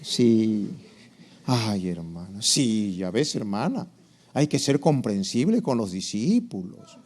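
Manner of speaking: low, soft mock-sympathetic agreement; hammed-up understanding church mentor; convinced discipler. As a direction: cave in with soothing, indulgent murmurs. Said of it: "a uno como que lo convence".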